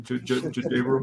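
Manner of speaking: "J-, J-, J-, J-Rum" with the J repeated over and over; exaggerated shivering stutter. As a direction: chatter the J of "J-Rum" many times. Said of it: "I put an extra J in there because I'm cold and I'm shivering".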